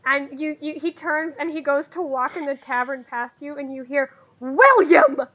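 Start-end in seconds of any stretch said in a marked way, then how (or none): 4.4-5.2 loud scolding call of a name; angry mother's yell. Acted. Mama spots her muddy son in the tavern, scolding yell. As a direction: holler it like a cross mom.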